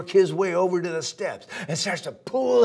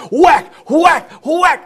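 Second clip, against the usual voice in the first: loud, high punchy whack sounds; storyteller voicing broom hits; 14 dB louder, slower than usual. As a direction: smack each word like a broom blow. Man hits gator with broom.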